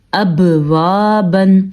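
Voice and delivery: bouncing qalqala on the ب of اب، وا، بن; slow recitation, ب echoed in اب before وا; با پر قلقلہ. Shown as a demonstration. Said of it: "ہم نے با کے اوپر قلقلہ ادا کیا ہے". (اب، وا، بن میں ب پر قلقلہ کے ساتھ)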